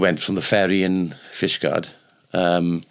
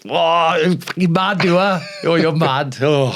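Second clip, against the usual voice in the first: high, loud and slow outraged shout; furious yell; 10 semitones higher, 4 dB louder, slower than usual. Retold or imitated. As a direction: bellow it at the big guy who pushed you. Furious man yells at pusher.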